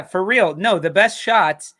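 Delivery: usual speaking voice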